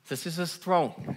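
a touch of a stern deity; setting up a mock angry God; lightly acted grumpy God; playing an angry God. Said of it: "I'm gonna play God".

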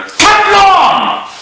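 high, loud sudden yell; screeching involuntary curse; shouted swear. Pretend. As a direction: blurt it out uncontrollably. Mock tic outbursts of swearing.

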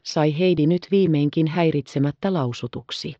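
plain reading voice

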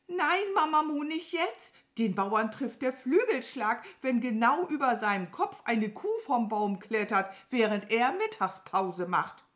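high, loud, scolding crow voice; alarmed crow. Acted. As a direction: forbid it urgently as the crow. (aufgeregt schimpfende Krähe)

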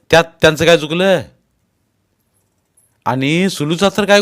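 loud, insistent fatherly lecture; stern scolding. A narrator's reading. Father telling husband to understand wife's parents, stern scolding. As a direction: reproach him and drive it home.

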